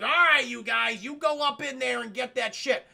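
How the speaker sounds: loosely imitated boss giving orders; Darth Vader. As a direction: send the troops in, mock-commanding.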